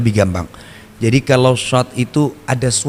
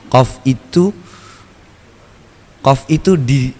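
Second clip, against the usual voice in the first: normal talking voice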